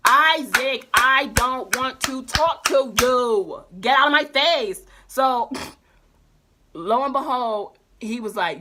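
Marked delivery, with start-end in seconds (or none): 0.1-4.8 louder, fed-up shout at him; loud telling off. Retold or imitated. Narrator yelling at classmate Isaac to leave, fed-up shout. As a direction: lose patience and yell it.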